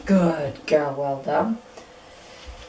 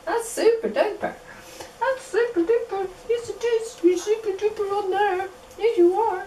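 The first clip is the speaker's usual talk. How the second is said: high sing-song puppy praise; squeaky praising a dog; 13 semitones higher than usual. Acted. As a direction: coo praise up high at the pup.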